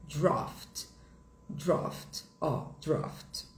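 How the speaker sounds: soft "draft" said as "drawft"; British "draft", rounded open o; British pronunciation. Shown as a demonstration. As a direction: say "draft" slowly with a back "aw" vowel. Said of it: "vai ficar no britânico O".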